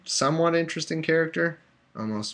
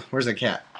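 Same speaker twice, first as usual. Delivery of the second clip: normal talking voice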